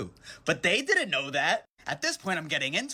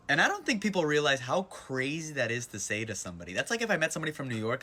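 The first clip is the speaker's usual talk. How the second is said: ordinary talk, nothing marked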